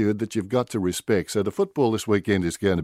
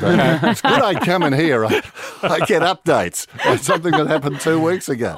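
louder, higher, through laughter; amused; 7 semitones higher, 5 dB louder than usual. Real, not acted. Guest joking with breakfast hosts about his outdated news, amused. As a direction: laugh along while joking at your own expense. Teasing banter, laughter heard.

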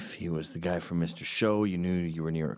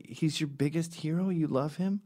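high teasing needle; mock challenge; 8 semitones higher than usual. Comedian goads a fan into buying beers, high and teasing. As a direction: egg the fan on to buy beers. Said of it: "and I'd say".

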